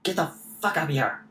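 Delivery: quick, restrained acted yell; shouting. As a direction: call them up from below without full volume. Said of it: "you hear Salamander shouting".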